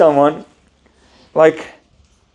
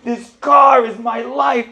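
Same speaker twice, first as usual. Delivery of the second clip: high, slow anguished shouting; livid; 10 semitones higher, slower than usual. Retold or imitated. Livid owner wails at the rabbi, high and slow. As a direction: plead his car is everything. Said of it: "He was livid".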